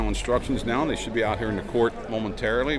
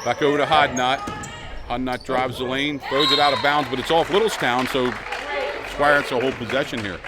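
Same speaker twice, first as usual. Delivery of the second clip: fast sports commentary; 5 semitones higher, 3 dB louder than usual. Acted. Live play-by-play of a game.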